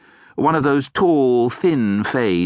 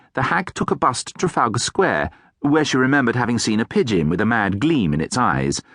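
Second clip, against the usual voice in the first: plain reading voice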